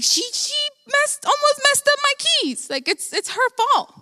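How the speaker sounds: very high-pitched mock protest; retold indignant blaming. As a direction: plead your case by blaming mom, voice way up. Retold argument blaming mom.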